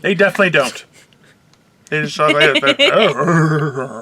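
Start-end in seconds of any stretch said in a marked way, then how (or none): 1.9-4.0 drawn-out mock neigh; slow the words into a whinny; horse impression. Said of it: "you do a way better horse than me".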